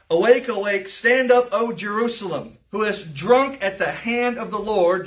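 calling out the text; emphatic scripture reading. Acted. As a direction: proclaim the verse to the room. Reads scripture aloud.

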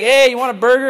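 light mock shout of an offer; friendly call-out. Imagined passerby calling to the desert preacher, friendly call-out. Acting someone offering a burger.

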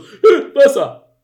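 very high, loud, dopey creature squeak; a confused beast blurting it out; dumb wolf voice. Retold or imitated. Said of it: "Und der Wolf so".

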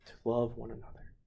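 hushed echo. Real, not acted. Softer echo.

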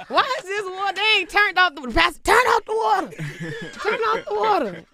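high, loud frantic shouting; mock panic, shouted high; panicked yell. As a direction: yell like the water's flooding the church. Panicked yelling about overflowing water.

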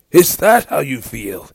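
slow, high, loud question; loud reply. A narrator's reading. Arthur challenging his brother's insult, loud drawn-out reply. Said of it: "Arthur replied loudly".

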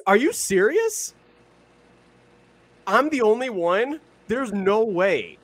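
real disbelief, pitched right up; incredulous. Real, not acted. Podcaster stunned nobody else saw the lookalike, incredulous. Disbelief at being the only one.

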